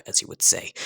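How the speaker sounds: plain reading voice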